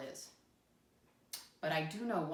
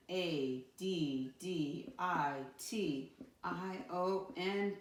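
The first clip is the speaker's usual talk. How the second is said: slow spelling; much slower than usual. Shown as a demonstration. Teacher spells word for class.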